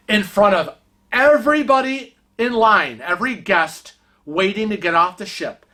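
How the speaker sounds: ordinary delivery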